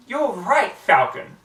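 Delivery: high and loud, triumphant; excited exclaim. Acted. Pony cheering her dozy falcon, loud and excited. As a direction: burst out with sudden glee. Triumphant idea, calling the bird.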